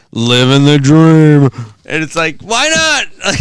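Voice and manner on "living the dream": very slow, louder, drawn-out deadpan; sarcastic. Retold or imitated. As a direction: stretch every word with heavy irony. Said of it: "they said it sarcastically".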